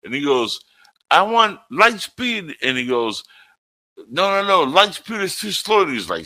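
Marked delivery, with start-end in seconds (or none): 1.1-2.6 slow high mock command. Quoting Spaceballs characters. 4.1-5.8 high mock movie voice. Quoting Spaceballs characters.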